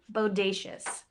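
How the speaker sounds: ordinary delivery, nothing marked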